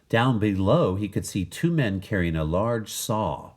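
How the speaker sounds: usual speaking voice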